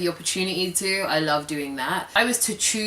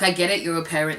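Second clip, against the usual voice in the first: ordinary talk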